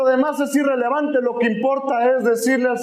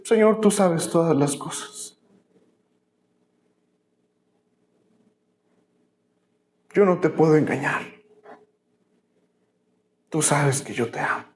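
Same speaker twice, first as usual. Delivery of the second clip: Peter confesses his love, almost crying; 7 semitones lower than usual. Acted. Preacher voicing Peter to Jesus, lower and near tears. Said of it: "yo me imagino casi llorando".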